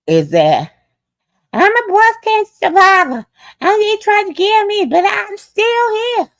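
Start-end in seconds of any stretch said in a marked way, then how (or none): 1.5-6.3 high mocking voice of her; mocking impression. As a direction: imitate her high and defiant. Mocking woman's defiant claim.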